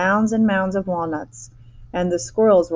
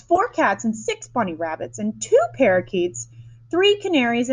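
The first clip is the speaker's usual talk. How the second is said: whiny bratty demands; 6 semitones higher than usual. A narrator's reading. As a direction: rattle off everything she owns, demanding more. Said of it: "shouted Veruca".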